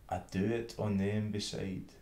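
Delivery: ordinary delivery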